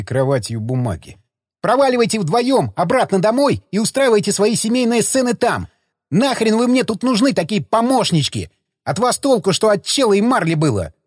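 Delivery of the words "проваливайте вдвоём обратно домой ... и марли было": furious yell. A narrator's reading. Alexander keeps yelling at pair.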